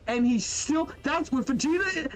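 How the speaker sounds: normal talking voice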